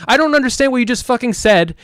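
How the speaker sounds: retold angry outburst. Man retelling how he scolded his roommate's friend, pissed off. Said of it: "I was so pissed at him".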